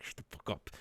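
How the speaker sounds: hissed under her breath; whispered. Retold or imitated. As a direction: whisper it sharply to him. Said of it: "She's like".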